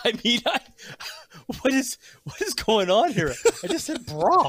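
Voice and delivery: high, amused protest; laughing disbelief. Real, not acted. Incredulous protest about the word.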